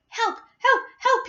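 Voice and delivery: very high, strained little cries; yelling for help. A narrator's reading. Augustus calls from the chocolate river, high yelling. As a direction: yelp for rescue. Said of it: "he yelled".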